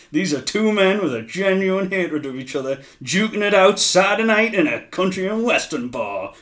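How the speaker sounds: loud, high, hammed-up commentator call; Mongo. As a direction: belt it out like an over-excited TV announcer. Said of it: "Mongo goes".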